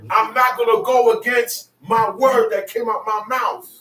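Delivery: rising to a shout as God; God's voice building. Acted. Preacher shouting God's promise at a rebellious people.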